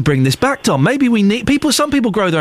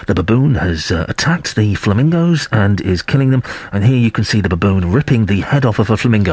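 low, measured nature-documentary narration; documentary voiceover; 10 semitones lower than usual. Retold or imitated. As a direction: narrate it like a wildlife programme voiceover. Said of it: "the voiceover's going".

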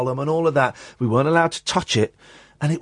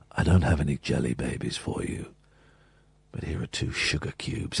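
Tom Baker's voice; 12 semitones lower, 9 dB quieter than usual. Retold or imitated. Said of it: "And he said".

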